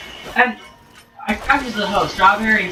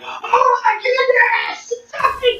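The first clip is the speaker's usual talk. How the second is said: high, slow hammed-up cry of joy; gushing at the E three trailer, hammed-up and high; mock excitement; slower than usual.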